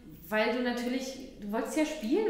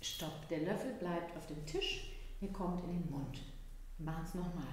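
leise, langsam und bestimmt; „Stopp“ ruhig und gedehnt, ohne Schärfe; ruhiges Stopp; 4 semitones lower, 8 dB quieter than usual. Shown as a demonstration. Mutter wiederholt Grenze ruhig. (Mutter erklärt dem Kind leise die Regel)

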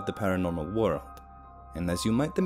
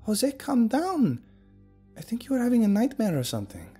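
calming; 14 semitones higher than usual. Acted. Calming a panicked friend.

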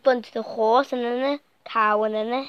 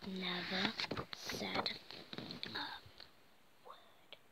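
whisper; 4 semitones lower, 18 dB quieter, slower than usual. A narrator's reading. Whispered story ending.

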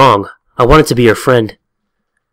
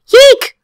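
very high, short squeaked yelp; high-pitched cartoon eek; startled squeak; 4 dB louder than usual. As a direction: squeak in mock alarm. Startled student caught by teacher.

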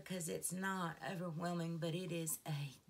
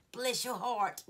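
Southern blessing; 5 semitones higher, 7 dB louder than usual. Pretend. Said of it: "she loves my accent".

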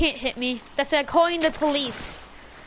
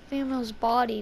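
ordinary talk, nothing marked

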